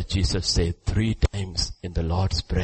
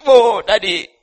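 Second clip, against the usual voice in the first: loud, high wail imitating a crying son; mock crying; 12 dB louder than usual. Preacher acting Esau crying to his father, mock wail. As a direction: cry out to daddy, hammed-up. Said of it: "Esau will also cry".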